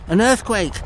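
high, loud cry of alarm; alarmed shout. A narrator's reading. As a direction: shout a sudden warning to everyone. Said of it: "somebody shouted".

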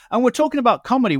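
upbeat host read. Acted. Host's radio link.

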